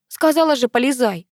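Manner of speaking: a touch of exasperated temper; cross. A narrator's reading. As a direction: order it with irritation. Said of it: "рассердилась я".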